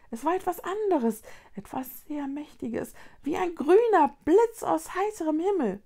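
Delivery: high little voice, swinging in volume; earnest Luni creature. A narrator's reading. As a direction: insist in a high-pitched creature voice. Voices the Lunis.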